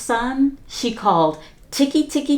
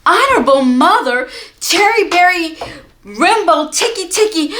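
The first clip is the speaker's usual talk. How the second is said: gasped out, high and loud; barely enough breath to get the name out; gasping; 9 semitones higher, 6 dB louder than usual. A narrator's reading. Chang gasping the jumbled name to his mother, high and loud. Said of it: "he gasped".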